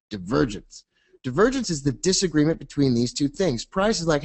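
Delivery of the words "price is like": ordinary delivery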